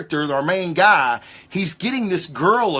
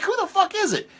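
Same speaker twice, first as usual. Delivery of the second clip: much higher, startled mock outburst; shocked disbelief; 3 dB quieter, faster than usual. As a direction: squeal it like you can't believe it. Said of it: "And I'm like".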